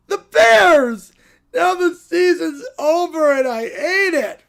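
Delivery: a sobbing Bears-fan puppet; upset crying bear. Acted. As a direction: blubber like a heartbroken fan. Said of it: "Bears fans really do cry a lot".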